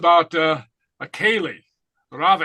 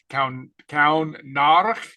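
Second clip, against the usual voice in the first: Kaun Narach drawn out slowly, syllable by syllable; slow down on Kaun Narach so students can follow; slow demonstration; slower than usual. Shown as a demonstration. Teacher slowing the Gaelic phrase for the class, slow and careful. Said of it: "the head of a snake".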